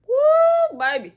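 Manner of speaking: high, loud whoop; excited whoop. Real, not acted. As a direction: whoop it out in delight at the result. Cheering her own makeup result.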